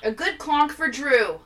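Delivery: lightly voiced town crier; slow, proclaiming herald call.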